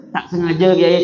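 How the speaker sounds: ordinary talk, nothing marked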